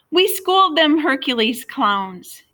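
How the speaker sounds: high, loud boastful crow. A narrator's reading. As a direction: gloat over the win.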